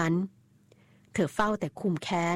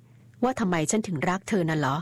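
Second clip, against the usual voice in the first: usual speaking voice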